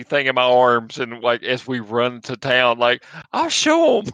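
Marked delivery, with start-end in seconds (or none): 3.3-4.1 high-pitched, fired-up boast; defiant in-character cry. Acted. Player voices character running to town with evidence, high defiant cry. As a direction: cry it out high, showing off the proof.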